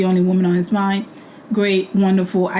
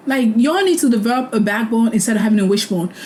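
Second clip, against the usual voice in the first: ordinary talk, nothing marked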